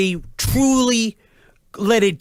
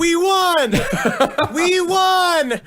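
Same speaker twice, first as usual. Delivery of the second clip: mock cheering; 8 semitones higher, much slower than usual. Followers cheering after the test.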